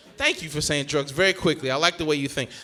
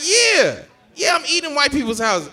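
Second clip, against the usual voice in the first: high, insistent yeah; acted defensive answer, pitched up; defiant admission; 9 semitones higher, 5 dB louder than usual. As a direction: own up to it defiantly.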